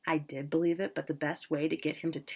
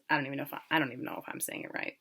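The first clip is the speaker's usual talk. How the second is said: soft, half-whispered, quicker aside; quiet aside; 3 semitones lower, 7 dB quieter, much faster than usual. Real, not acted. Reader breaks off to doubt the word, half-whispered. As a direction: drop out of the story and mutter the doubt under your breath.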